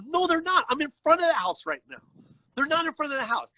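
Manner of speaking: retold exasperation, pitched well up; indignant contradiction. Telling dispatch nobody is outside the house, indignant contradiction. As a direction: flatly contradict in a high, insistent voice. Arguing with dispatcher about location.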